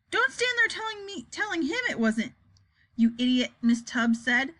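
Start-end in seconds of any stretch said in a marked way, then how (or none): 0.1-3.5 high, cross telling-off; scolding. A narrator's reading. Miss Tibbs telling off the interpreter, high and cross.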